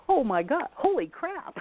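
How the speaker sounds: high-pitched amazed exclamation; stunned by a UFO; astonished. Retold or imitated. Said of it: "I'm standing there going".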